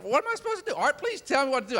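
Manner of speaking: high-pitched, pleading exasperation; frustrated. Retold or imitated. Recruit pleading with his mentor, frustrated. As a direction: beg for help in mock desperation. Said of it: "I just get more frustrated".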